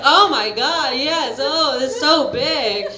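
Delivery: loud, breathy mock moan, slowing on "so big"; fake moaning. Faking pleasure during oral sex, loud hammed-up moaning. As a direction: ham up a fake orgasm. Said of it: "just be like".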